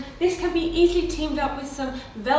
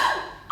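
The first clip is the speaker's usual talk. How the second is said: gasp. Real, not acted. Learns it is made of glass.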